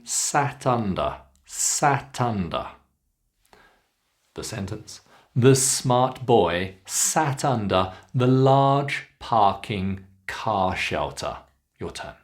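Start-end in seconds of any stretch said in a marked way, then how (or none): 0.0-2.7 slow "sat under", joined as sa-tunder; linked words. Shown as a demonstration. Teacher shows connection, slow linked "sat under". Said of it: "Notice the connection". 5.4-11.4 slow, long "smart", "large", "parking", "car". Shown as a demonstration. Teacher models practice sentence, slow long ah in "smart". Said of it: "keep the R sound nice and long".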